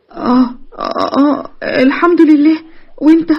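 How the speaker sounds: slow, halting, tongue-tied reply; shy, stammering answer; nervous stammer. Acted. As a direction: stumble over the words, flustered. Nermin flustered, facing Ahmed.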